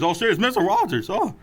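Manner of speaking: high shocked outburst; surprised. Real, not acted. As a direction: blurt it out in disbelief. Shocked at Mister Rogers appearing.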